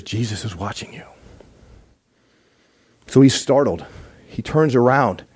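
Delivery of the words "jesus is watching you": eerie whisper; spooky voiceless whisper. Retold or imitated. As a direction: breathe it like an unseen voice in the dark. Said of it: "He hears".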